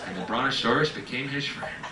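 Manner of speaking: grand Bible-story narration with hammed-up disciples; mock scripture storytelling. Comic recites a lizard gospel to the crowd, mock scripture storytelling. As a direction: proclaim it like a parody gospel.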